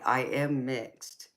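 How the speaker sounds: usual speaking voice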